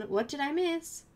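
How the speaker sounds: ordinary delivery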